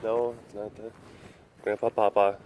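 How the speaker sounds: normal talking voice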